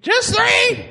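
very high parrot squawk; shrieking parrot echo; awful parrot. Acted. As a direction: screech it back like a parrot. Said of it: "he's like an awful parrot".

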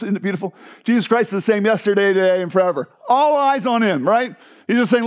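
ordinary delivery

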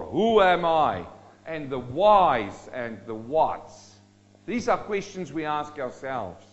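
usual speaking voice

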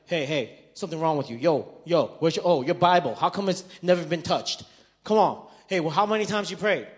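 loud, badgering mock nag; confronting friend. Acted nagging confronting friend.

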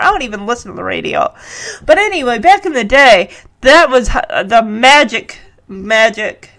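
plain reading voice

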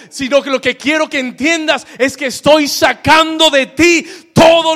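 loud, high-pitched sermon climax; full-force preaching. Real, not acted. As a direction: proclaim God's promise at full volume. (predicación a toda voz)

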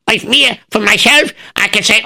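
plain reading voice

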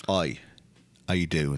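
imitated English wrestler's greeting; mock British wrestler hello; British accent. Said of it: "he's a little bit more".

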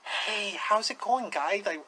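quiet, gentle mock boxer voice; Mike Tyson. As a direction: say it small and soft, imitating a famous boxer. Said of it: "Mike Tyson".

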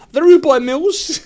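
loud, high, slow, with laughter; big up the act like an MC; hype announcer. Pretend. Introducing a joke band on a talent show, hyped MC. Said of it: "hype announcer intro".

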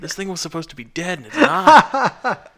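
high, loud rush of amazement breaking into laughter; thrilled, building to a loud, laughing high finish; retold excited boast. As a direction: relive showing off to a buddy. Retold showing friend the live scorpion.

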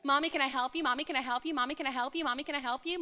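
repeated sing-song rising question; a little kid tugging at a sleeve; pestering child. Retold or imitated. Child nagging mommy with offers of help, sing-song.

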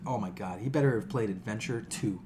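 ordinary talk

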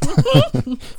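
high laughing burst; burst of laughter. Real, not acted. Friend attempts to chug.

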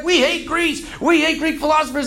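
high-pitched group complaint; mock protest. Conquered people reject Alexander's books, in mock protest. Acts people refusing Greek philosophy.

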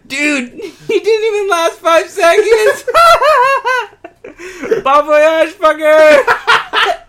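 high laughing yell; mocking laugh-shout. God mocking Ortiz after the knockout, laughing shout. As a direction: cackle it out while shouting.

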